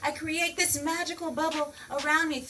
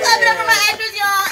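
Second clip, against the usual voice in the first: hype call; 7 semitones higher, 12 dB louder, faster than usual. Acted. Calling out to audience.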